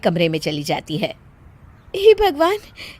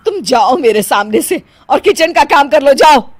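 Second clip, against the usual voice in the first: louder, commanding her out; angry order; 2 semitones higher, 5 dB louder than usual. Acted. Mother-in-law sends bahu away.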